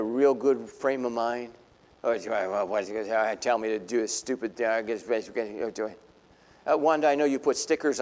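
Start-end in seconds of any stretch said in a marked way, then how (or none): 2.0-6.0 grumbling. Retold or imitated. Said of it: "Have you ever grumbled".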